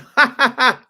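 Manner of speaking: high burst of laughter; sudden laugh. Real, not acted. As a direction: laugh out at being stumped. Stumped by the next card.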